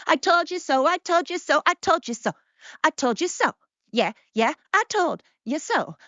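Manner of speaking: put-on high, childish I-told-you-so singsong; gloating taunt. Host celebrating being immature for twenty-four hours, gloating taunt. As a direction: chant it like a playground taunt.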